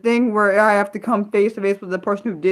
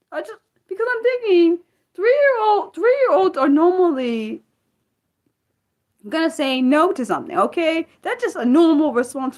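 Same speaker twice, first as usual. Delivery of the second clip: heated outburst; 9 semitones higher than usual. Real, not acted. Vlogger to viewers about a three-year-old victim, heated high-pitched plea. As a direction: voice jumps high and stays there. Upset over the child case.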